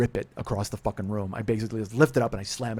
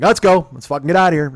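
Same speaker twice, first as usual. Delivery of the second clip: louder, higher, curt; angry command; 5 semitones higher, 11 dB louder than usual. Retold or imitated. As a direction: snap that you are leaving. Storming off angrily.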